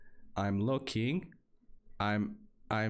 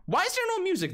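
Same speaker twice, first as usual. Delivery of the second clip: squeaky complaint; 4 dB louder, much faster than usual. Real, not acted. Comic high complaint.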